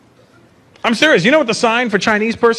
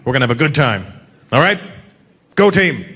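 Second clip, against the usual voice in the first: team captain pep talk; 7 semitones lower than usual. Retold or imitated. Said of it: "other countries in their huddle".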